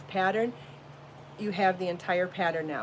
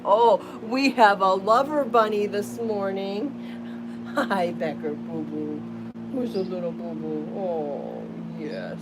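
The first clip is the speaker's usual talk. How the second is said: cooing to a pet; 4 dB louder, slower than usual. Real, not acted. Owner cooing sweetly at her lover bunny. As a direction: coo over the sweet little pet.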